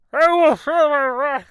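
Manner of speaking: huge, slow, high-pitched roar; booming dramatic voice. Pretend. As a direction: bellow it like a cartoon villain. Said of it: "and then just be like".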